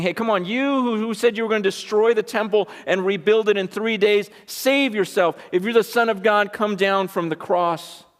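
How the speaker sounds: high, scornful mock challenge; jeering crowd. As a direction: act out passers-by goading a victim. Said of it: "shaking their heads and they're saying".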